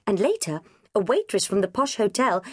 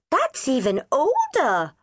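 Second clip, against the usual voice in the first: high, slow child's voice, mock-sage; wise little girl; 8 semitones higher, slower than usual. A narrator's reading. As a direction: pronounce it like a solemn five-year-old. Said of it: "Debbie said wisely".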